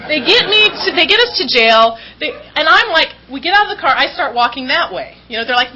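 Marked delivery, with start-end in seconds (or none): none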